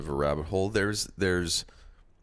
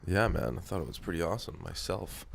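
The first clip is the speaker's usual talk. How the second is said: ordinary talk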